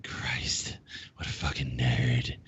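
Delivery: low, whispered disgusted wife; muttered wife aside. Retold or imitated. Host imitates wife scoffing at him, low whisper. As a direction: breathe it low like an unimpressed wife.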